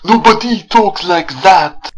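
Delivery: put-on high, drawn-out phony French accent; mock French accent. Reviewer mocking the actress's delivery, phony French accent. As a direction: exaggerate a French accent, loud and slow. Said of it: "that phony French accent".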